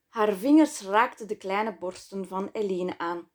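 ordinary delivery, nothing marked

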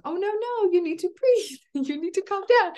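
hammed-up high nagging parent; mock stressed parent. Mocking parent telling kids to breathe.

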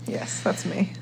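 soft unvoiced whisper. Real, not acted. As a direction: whisper it shyly. Shy reply to introduction.

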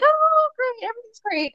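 imitating her own fake smile, sarcastic; mock cheerful. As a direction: put on a forced happy face voice. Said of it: "like, smiling".

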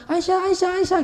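acted-out call after a departing wife; calling after someone. Husband chasing his wife Aisyah, calling out.

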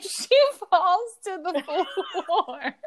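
high, laughing through the words; fail to hold back real laughter. Real, not acted. Telling co-host about Britney's fall, laughing. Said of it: "I don't mean to laugh".

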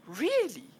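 high, slow, wide-eyed questions; high-pitched amazed curiosity; eager curiosity. Acted. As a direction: gush with fascinated interest at the butler. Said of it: "she'll go".

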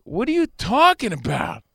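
retold protest, pitch jumping up; defensive. Boyfriend left out of the loop, defensive. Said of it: "I immediately get defensive".

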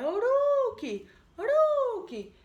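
high, loud, drawn-out call of a name; a haunting voice luring the boy toward the shore; eerie calling voice. Acted. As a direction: call him slowly from far across the sea.

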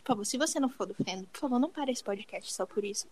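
unvoiced whisper begging softly; hushed plea to listeners. Real, not acted. Pleading aside to listeners.

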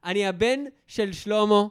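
high, loud call down the street; calling out to a fleeing cousin in the street; retold shout. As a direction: shout after her as she runs off. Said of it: "אני צועק לה באמצע מנאלי ברחוב".

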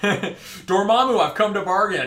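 slow, drawn-out heroic movie line; a grand mock Doctor Strange; Doctor Strange quote. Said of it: "how many times Dormammu?".

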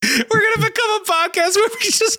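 very high, hyped-up mock shriek; manic screaming. Streamer hyping lore to baffled co-host, manic squeal. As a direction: squeal it with over-the-top hype. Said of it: "I'm sorry for screaming into the mic".

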